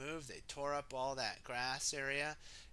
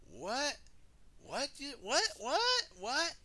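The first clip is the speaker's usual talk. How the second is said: rising, ever higher questions; baffled what; 11 semitones higher, 4 dB louder than usual. Acted. The team hearing Dizzy's idea, rising disbelief. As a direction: climb higher with each what.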